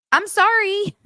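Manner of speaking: high, loud mocking "I'm sorry"; sarcastic sorry, way up high; wrong way to say sorry. Shown as a demonstration. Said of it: "You can't say sorry like that".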